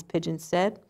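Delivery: usual speaking voice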